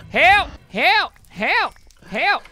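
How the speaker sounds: high, loud repeated cries; calling for help. Real, not acted. Gamer calling friends for help, high shouts. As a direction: yell out in short bursts for rescue.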